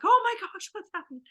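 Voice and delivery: high startled exclaim; jolted awake in alarm, pitch shooting up; startled parents' high gasp of alarm. Retold or imitated.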